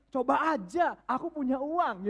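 slow, high, dreamy wish; wistful wishing. Retold or imitated. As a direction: read it as a hopeful wish. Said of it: "Istrinya optimis". (istri berharap penuh angan)

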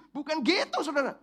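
loud, driving sermon climax; full-force preaching. Acted.

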